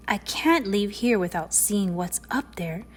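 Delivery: plain reading voice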